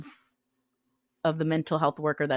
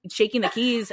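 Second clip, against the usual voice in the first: normal talking voice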